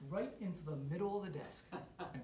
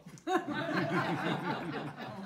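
laughing; 6 dB louder than usual. Real, not acted. Laughing at father's prank.

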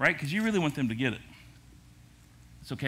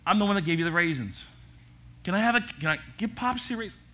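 exasperated plea; 6 semitones higher, 4 dB louder than usual. Retold or imitated. Pleading with grandson for raisin.

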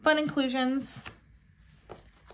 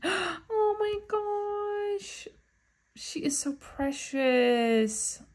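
very slow, higher, near-tearful gush; tender gushing; 10 semitones higher, much slower than usual. Real, not acted. Fan moved by a precious photo card, slowly gushing near tears. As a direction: melt over the card, almost crying.

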